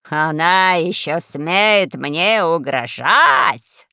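slow, drawn-out outraged cry; pompous admiral's mild yell; indignant shout. A narrator's reading. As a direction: bellow it slowly, stretching the words. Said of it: "закричал адмирал".

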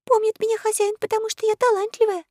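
tiny, very high voice; high-pitched girlish aside; whispered confiding. A narrator's reading. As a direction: confide it proudly in a little squeak. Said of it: "прошептала Заори Алисе".

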